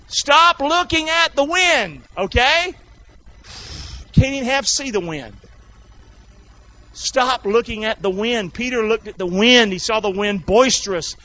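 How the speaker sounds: high, insistent preacher's voice; forceful preaching. Real, not acted. Preacher urges congregation onward through the storm, forceful preaching.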